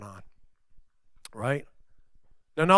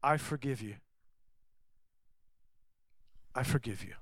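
much softer, gentle; tender forgiveness; 3 semitones lower, 11 dB quieter, faster than usual. Retold or imitated. Scene: Jesus answers critics, soft tender forgiveness. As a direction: let the forgiveness land softly. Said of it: "Jesus says".

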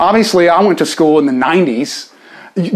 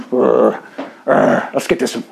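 lightly voiced grumbler, a touch lower; grumbling Pharisees; 4 semitones lower than usual. Preacher imagining the Pharisees ganging up, mock grumbling. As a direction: grunt like a plotting heavy. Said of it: "You can just imagine these guys".

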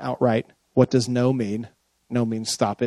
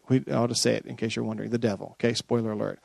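softer, quicker confiding aside; quiet aside; 4 semitones lower, 8 dB quieter, faster than usual. Real, not acted. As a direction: let them in on a secret. Quiet aside about the devil.

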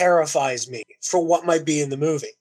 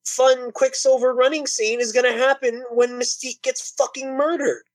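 excited; 9 semitones higher than usual. Real, not acted. Outraged rant about Mystique scene.

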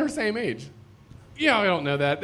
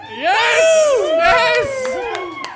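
high, loud cheering yell; excited shout; 8 dB louder than usual. Real, not acted. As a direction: cheer the right answer. Correct guess cheered.

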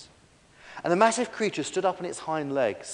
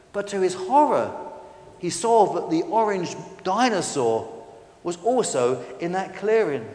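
usual speaking voice

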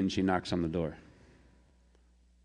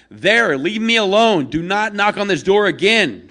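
furious shout; 12 semitones higher, 12 dB louder than usual. Acted. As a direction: order her away for good. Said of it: "and goes".

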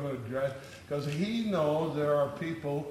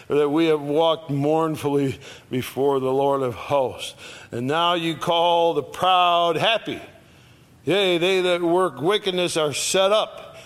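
higher, louder preaching of the verse; preached scripture; 4 semitones higher, 4 dB louder than usual. Acted. Preacher reading Malachi to his congregation, at full force.

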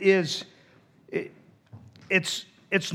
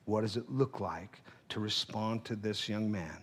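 inner voice; 8 semitones lower, 13 dB quieter than usual. Retold or imitated. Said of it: "And in my heart".

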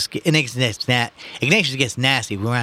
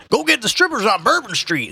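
put-on higher-pitched old-timer's heckle; old man; 13 semitones higher, 4 dB louder than usual. Old man heckling the policeman from the crowd, cranky. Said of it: "an old man added".

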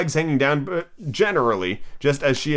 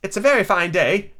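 higher-pitched tentative small talk; timid voice; 7 semitones higher than usual. A narrator's reading. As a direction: make nervous conversation shyly. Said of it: "said a timid voice".

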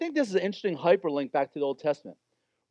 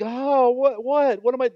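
higher, louder, flustered double-take; startled Moses; 7 semitones higher, 8 dB louder, slower than usual. Acted. Moses shocked at his own leprous hand, yelping higher. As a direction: jolt awake in alarm, much higher and louder.

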